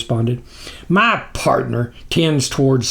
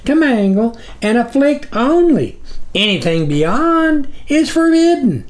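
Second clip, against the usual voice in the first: groveling minion; 8 semitones higher than usual. A narrator's reading. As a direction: plead upward to your dark master. Snuffer explains the rules.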